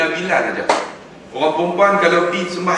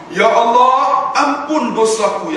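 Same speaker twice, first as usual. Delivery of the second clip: Ya Allah ampun dosaku drawn out slowly, higher and louder; earnest prayer; 8 semitones higher, 4 dB louder, much slower than usual. Shown as a demonstration. Preacher showing pilgrims how to pray, slow earnest plea. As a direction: plead it slowly like a heartfelt prayer. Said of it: "Doa macam mana?".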